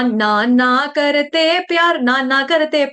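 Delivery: "ना, ना करते प्यार" half-sung with long open aa in each ना; wrong long naa. Shown as a demonstration. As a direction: lilt "ना, ना करते" like a song snippet. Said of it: "ना, ना नहीं है, ये न". ("ना, ना करते प्यार" गाने जैसा, लंबा ना)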